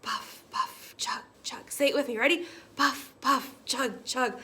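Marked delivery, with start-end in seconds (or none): 0.1-1.7 soft unvoiced puffing; whispered engine sound. A narrator's reading. Storyteller whispering the engine sounds to children. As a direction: breathe out the chugs.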